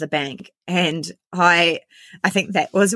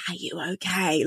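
hushed, barely voiced check-in; mouthed question; 8 dB quieter than usual. Receptionist secretly checking on the customer, hushed and mouthed. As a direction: mimic mouthing it in secret, very softly. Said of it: "she literally mouthed to me".

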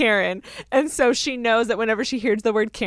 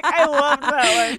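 laughing; 3 semitones higher, slower than usual. Real, not acted. Laughing, slower.

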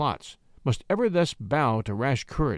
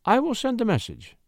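much higher, resolute lady; lady's voice; 10 semitones higher than usual. A narrator's reading. The lady volunteers to send the message, in a high voice.

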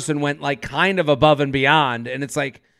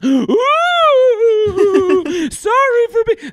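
ghost voice; 4 dB louder, much slower than usual. Pretend. Riffing on the ghost text.

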